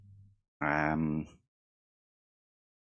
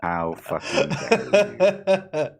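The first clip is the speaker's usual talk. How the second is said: slow, high, loud mock indignation through laughter; hammed-up offended Scouser, cracking up; mock outrage laughing; 7 semitones higher, 5 dB louder, much slower than usual. Friend hams up offence at being called Manc, laughing outrage.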